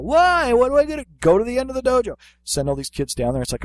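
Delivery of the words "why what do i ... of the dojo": put-on much higher, fast, complaining child's voice; whiny kid. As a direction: play the protesting little kid. Kid's voice whining back.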